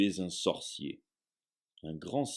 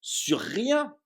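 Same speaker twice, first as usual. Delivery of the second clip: higher, slow, outraged roar; 8 semitones higher, 5 dB louder, slower than usual. Acted. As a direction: drag out the disbelief in a roar. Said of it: "rugit Hagrid".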